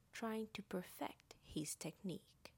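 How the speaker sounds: ordinary delivery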